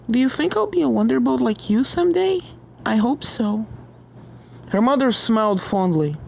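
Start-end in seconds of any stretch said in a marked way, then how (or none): 0.1-3.6 put-on higher, childlike eager voice; hopeful little filly. Jitterbug asks her mother about the Wonderbolts, childlike.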